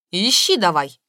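loud, commanding shout; angry cry. A narrator's reading. Aunt orders the narrator to start searching, loud shout. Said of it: "вскричала тётя Галя".

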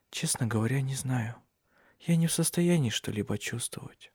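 very soft, drained confession; listless. A narrator's reading. Bedridden Cecilia unable to feel anything, listless. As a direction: let it out faintly, without energy. Bedridden, numb woman answers.

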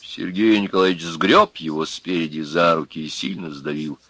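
usual speaking voice

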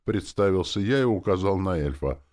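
usual speaking voice

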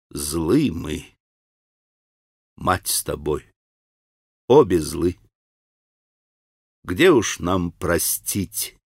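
low, slow, poisoned with spite; venomous voice. A narrator's reading. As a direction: drip venom into every word. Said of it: "ядовитым, отравленным злобой голосом".

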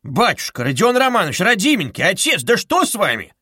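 a mild startled fuss; fussing alarm. Acted. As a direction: fuss over him in alarm.

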